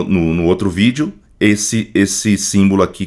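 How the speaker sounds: usual speaking voice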